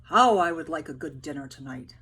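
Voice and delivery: loud, longing soldier's cry; a weary soldier wishing aloud for dinner; tired soldier calling out for food; hungry soldier. Acted. First soldier voiced in story.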